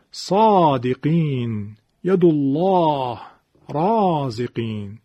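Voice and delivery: slow examples with long, dark, full ā; heavy alif after heavy letters. Shown as a demonstration. Teacher giving tafkhim examples, long heavy alifs. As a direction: draw out and thicken each ā in sādiqīn, Allāh, rāziqīn. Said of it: "اینا همه الفاشون به خاطر اینکه حرف".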